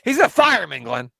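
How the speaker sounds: hammed-up insistence to Glenn; mock insistent. Repeats joke louder, mock insistence.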